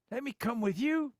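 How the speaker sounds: the healed man begs Jesus, pleading high; begging. A narrator's reading. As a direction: plead not to be left behind. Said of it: "begged the man".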